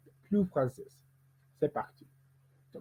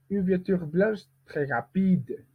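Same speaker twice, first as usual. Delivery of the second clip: "une voiture blanche très rapide" pitched up, weight on "rapide"; group-final stress; 5 semitones higher than usual. Shown as a demonstration. Teacher lengthening the phrase, stress on "rapide". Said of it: "à la fin de chaque groupe rythmique".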